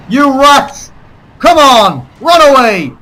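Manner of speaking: high, loud mock war cries; battle taunt shout. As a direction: yell taunts at a fleeing enemy. Yelling at enemies in game.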